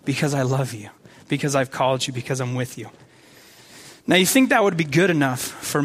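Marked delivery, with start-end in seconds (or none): 0.0-2.9 low, hushed and tender; intimate reassurance. Acted. God answering pleading Moses in the tent, intimate soft reassurance. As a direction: answer softly, dropping lower and quieter. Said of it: "It's an intimate moment".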